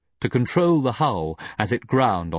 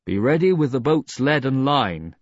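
raised voice, calling; 6 semitones higher, 3 dB louder than usual. A narrator's reading. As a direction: call down the boat. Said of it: "He called".

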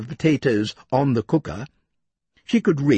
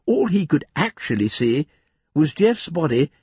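ordinary delivery

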